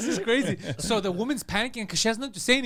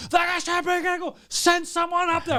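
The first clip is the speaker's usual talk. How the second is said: high-pitched frantic demand; acted-out angry alarm; retold going crazy; 10 semitones higher, 3 dB louder than usual. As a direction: urge the front desk to act, pitched way up. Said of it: "I'm going fucking crazy".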